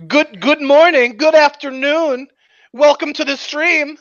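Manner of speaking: high-pitched, hammed-up welcome; mock stream host greeting. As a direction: greet Australian newcomers in an exaggerated high voice. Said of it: "then all you're gonna have is fucking Australians".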